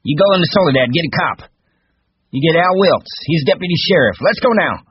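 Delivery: high, loud, commanding; urgent orders. A narrator's reading. Curly sends Witt for the deputy, urgent. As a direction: send them off with shrieked urgency.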